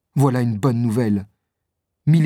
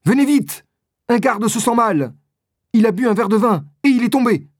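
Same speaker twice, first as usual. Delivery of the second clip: urgent, much higher, louder, fast alarm; shouting; 9 semitones higher, 3 dB louder than usual. Acted. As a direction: call for help in a panic. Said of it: "Planchet crie".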